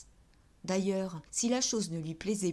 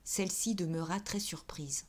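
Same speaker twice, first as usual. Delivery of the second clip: ordinary delivery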